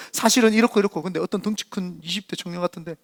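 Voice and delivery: usual speaking voice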